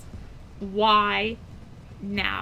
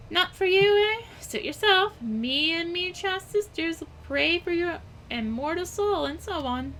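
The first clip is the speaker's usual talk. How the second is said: a higher pitched sister brushing you off; 7 semitones higher than usual. Acted. Sister NPC refusal reply.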